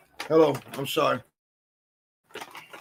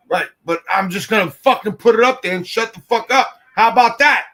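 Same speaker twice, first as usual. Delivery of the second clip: loud, raised-pitch irritated yell; 5 semitones higher, 7 dB louder than usual. Real, not acted. Co-host overruled on the TikTok filter, yelling back. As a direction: shout the other person down. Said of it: "Why are you yelling?".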